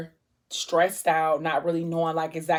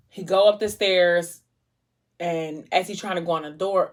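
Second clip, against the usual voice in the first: normal talking voice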